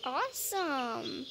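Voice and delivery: ordinary delivery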